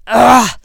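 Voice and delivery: loud, high grunting scream; short, forceful "ugh" yelled out; enraged scream. A narrator's reading. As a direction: scream with effort while attacking. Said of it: "Lucius screamed as he shot".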